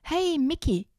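high, casual hello to a buddy; friendly chameleon greeting. Acted. Chameleon greeting the monkey Mickey, high friendly hello. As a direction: greet him like an old pal. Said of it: "grüßte das Chamäleon".

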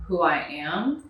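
ordinary talk

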